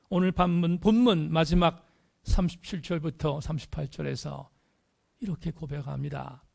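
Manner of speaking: ordinary talk, nothing marked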